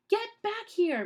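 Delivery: high, loud shout after them. A narrator's reading. Mister Poe yelling after fleeing Count Olaf, high shout. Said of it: "Mister Poe shouted".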